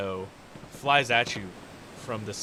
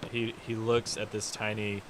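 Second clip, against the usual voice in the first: ordinary delivery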